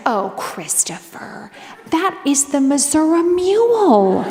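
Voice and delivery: put-on slow prim old lady, rising proudly at the end; proud grandma correcting him; prim older lady voice. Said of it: "And she was like".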